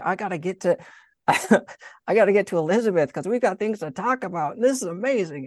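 high-pitched eager rush of news, breaking into a laugh; hustling excitement. Retold or imitated. Mary eager to reach Elizabeth with news, hustling and excited. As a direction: act out someone bursting to go and share news. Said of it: "already hustling".

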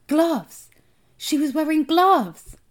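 high, loud cry of alarm; alarmed outburst. A narrator's reading. Boy spotting the strange woman's gloves, alarmed. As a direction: exclaim it high and loud, drawing out the second phrase.

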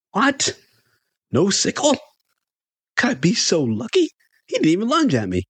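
high-pitched amazed disbelief; astonished. A narrator's reading. Fan amazed the wrestler spares him, astonished. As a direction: marvel at it, pitch shooting up. Astonished relieved thought in story.

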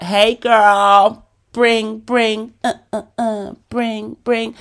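perky alarm clock calling out a ringing bring bring. Retold or imitated. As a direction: play the chirpy alarm trying to wake her. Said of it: "My alarm was like".

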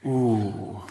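usual speaking voice

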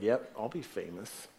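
soft, sly murmur; hushed, pleased with yourself; quiet smug aside. Retold or imitated. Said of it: "everyone in here would be like".

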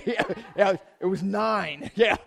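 laughing through the words; amused laughing. Real, not acted. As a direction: chuckle at your own admission. Laughing about nine weeks spent.

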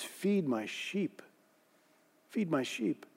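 hushed, slow "Feed my sheep"; tender command. A narrator's reading. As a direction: speak it softly as a loving charge. Jesus gently restores Peter.

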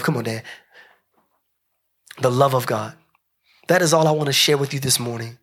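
ordinary talk

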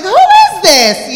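high, loud, drawn-out retold astonishment; mock amazement. Singer imitating her family discovering [name], mock amazement.

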